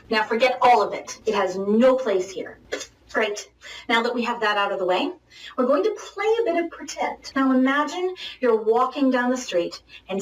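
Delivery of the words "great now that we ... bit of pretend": ordinary delivery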